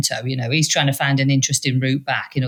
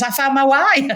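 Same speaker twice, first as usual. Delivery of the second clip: happy; 10 semitones higher, 3 dB louder than usual. Retold or imitated. Retelling stranger's joyful news.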